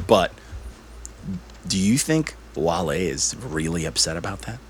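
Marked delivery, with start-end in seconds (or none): 1.7-4.6 whispering. Real, not acted. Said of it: "Why, why are you whispering?".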